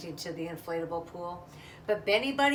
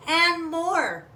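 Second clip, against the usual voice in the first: high, loud gleeful "and more"; excited build; 9 semitones higher, 10 dB louder than usual. A narrator's reading. Narrator reading to children, gleeful build. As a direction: let the glee burst out.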